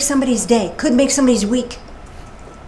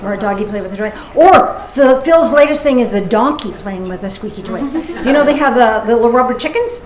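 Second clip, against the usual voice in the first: normal talking voice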